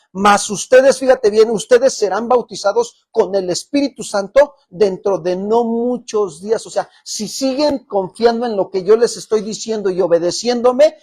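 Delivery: loud, driving sermon delivery; preaching at full force. Acted. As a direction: preach it out to the whole church. Pastor preaching loudly to congregation. (predicación a todo pulmón)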